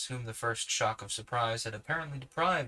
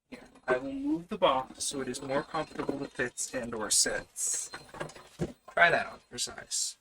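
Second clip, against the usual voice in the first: talking to the cat. Real, not acted. Scolding the cat mid-reading.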